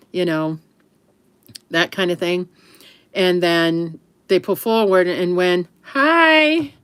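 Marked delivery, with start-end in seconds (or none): 5.8-6.7 cheery greeting. Retold or imitated. Greeting truckers over speaker.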